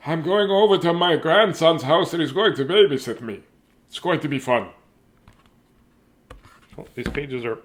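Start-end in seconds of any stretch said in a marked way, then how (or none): 0.1-4.7 grandpa voice. Acted. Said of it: "grandpa speaking in the book".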